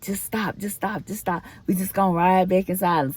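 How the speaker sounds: lightly voiced weary boyfriend; imitating fed-up boyfriend. Retelling boyfriend cutting off argument.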